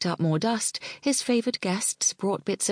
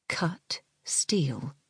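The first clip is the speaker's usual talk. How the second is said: whispered; 4 dB quieter than usual. A narrator's reading. Translation in awed aside.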